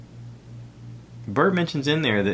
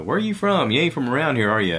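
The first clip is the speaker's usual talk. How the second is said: much higher, louder mock local guy; mock local coworker; 6 semitones higher, 4 dB louder than usual. Coworkers on the truck asking where he's from, mock local voice. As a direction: ham up the coworker's question.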